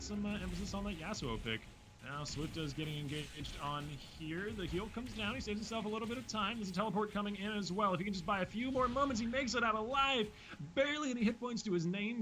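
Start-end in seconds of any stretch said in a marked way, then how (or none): 2.0-12.2 high-pitched running commentary, building in urgency; live play-by-play. Real, not acted. Caster tells viewers of a player barely getting out alive, urgent high play-by-play. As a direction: call the narrow escape as it unfolds.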